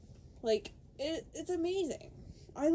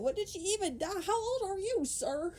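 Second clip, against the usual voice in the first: high, disbelieving ask; high incredulous sir; incredulous question; 10 semitones higher than usual. Retold or imitated. Mock questioning the character.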